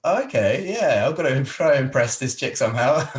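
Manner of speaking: keen to impress, half laughing; eager inner voice. Retold or imitated. Retold eager inner thought.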